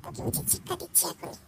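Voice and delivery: fast, soft muttered threat under the breath; muttering to herself. Acted. Muttering alone before her friend asks what she's saying. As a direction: grumble a threat to nobody in particular. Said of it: "একা একা বিড়বিড় করে কি বলছো?".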